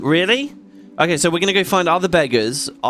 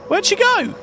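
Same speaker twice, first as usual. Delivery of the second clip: excited chase; 13 semitones higher than usual. Real, not acted. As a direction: voice jumps much higher, urgent. Chasing a fast thief in game.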